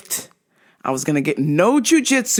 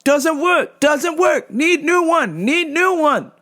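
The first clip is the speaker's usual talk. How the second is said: much higher, strained, broken English pleading; a mock desperate customer begging for a stronger cream; 10 semitones higher, slower than usual. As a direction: squeak out the demand like a desperate, out-of-it customer.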